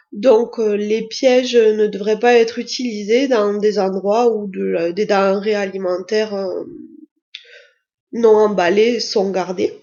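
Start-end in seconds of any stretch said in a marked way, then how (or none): none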